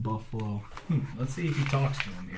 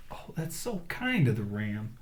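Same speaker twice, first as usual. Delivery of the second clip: ordinary delivery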